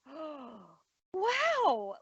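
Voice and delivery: high, breathy-free gasp of surprise turning into wow; retold surprised wow; mock surprise. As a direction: act pleasantly startled. Retelling her surprised reaction.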